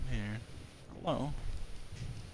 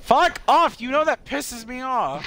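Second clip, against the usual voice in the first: high, loud mock-angry yell; play-angry at the teasing; mock outrage; 13 semitones higher, 5 dB louder than usual. Real, not acted. Gamer yelling at friend mocking his pronunciation, mock angry.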